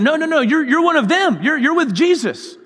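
high-pitched, pressing accuser; acted finger-pointing, pitch jumping up; insistent accusation. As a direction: imitate a bystander calling someone out. Crowd accusing Peter by the fire.

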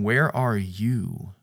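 slow "Where are YOU?" leaning on "you"; God calling the person, stress on "you". Shown as a demonstration. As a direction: land the weight on "you". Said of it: "Emphasis, you".

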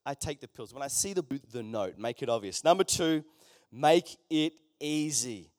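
ordinary delivery

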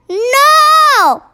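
high, loud, drawn-out wail; anguished cry. A narrator's reading. BFG answering Sophie's whisper, high pained cry. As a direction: cry out as if in pain. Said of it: "cried the B F G".

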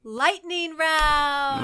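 drawn-out booming announcement; announcer voice. Acted. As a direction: call it out slow like a game show host. Announcing the lightning round segment.

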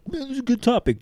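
high-pitched mock enthusiasm; jazzed up. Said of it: "when you're jazzed up about something".

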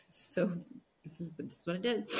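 quiet embarrassed laugh; a little laugh before admitting it; rueful laugh; soft rueful laugh. Real, not acted.

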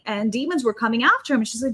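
ordinary delivery

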